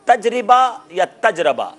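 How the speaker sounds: slow "تجربہ یا تجربہ", first taj-ri-ba, then taj-ra-ba; "تجربہ یا تجربہ" drawn out, middle vowel i, then a; contrasting two pronunciations. Shown as a demonstration. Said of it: "تلفظ میں کیا انہوں نے صحیح بتانا ہے".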